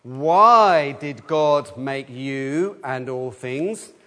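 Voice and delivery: each word spaced out, slow and deliberate; slow recitation. Shown as a demonstration. Leader reading the catechism question to children, slow and deliberate. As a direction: read it out slowly for the children to follow. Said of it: "Third question".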